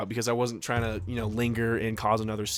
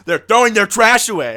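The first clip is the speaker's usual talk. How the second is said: exasperated friend; 9 semitones higher, 10 dB louder than usual. Retold or imitated. Winston voiced, exasperated.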